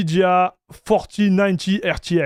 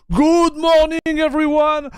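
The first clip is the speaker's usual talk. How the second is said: big cheery greeting; 10 semitones higher than usual. Acted. Streamer opening the stream to his viewers, high cheery hello. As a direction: sing out the good morning to the whole room.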